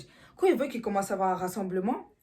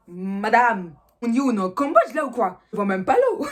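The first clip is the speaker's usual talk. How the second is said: louder, indignant taunt; mock outrage; 2 semitones higher, 8 dB louder than usual. Girl taunts her rival in the schoolyard, loud and indignant. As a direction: call her out in front of everyone.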